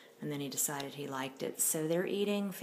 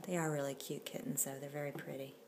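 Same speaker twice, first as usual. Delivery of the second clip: usual speaking voice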